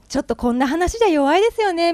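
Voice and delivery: lightly voiced humble guy; modest storyteller. Storyteller admitting story is weak, light mock modesty. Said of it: "みたいな感じで彼言ってたんですけど".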